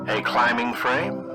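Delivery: usual speaking voice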